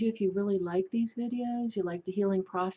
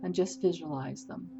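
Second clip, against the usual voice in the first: unhurried meditation leader with long pauses; guided meditation; 4 dB quieter than usual. Acted. Host leading listeners through a healing process, guided meditation. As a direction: guide them gently through a healing, phrase by phrase.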